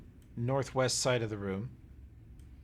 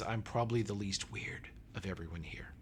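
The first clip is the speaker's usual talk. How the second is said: whispered aside; 4 semitones lower, 6 dB quieter than usual. Acted. Aside inside [name]'s introduction.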